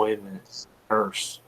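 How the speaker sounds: hushed, voiceless "it's a curse"; whispered realization. Retold or imitated. Hunter remembering the curse, frightened whisper. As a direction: breathe it out in dread.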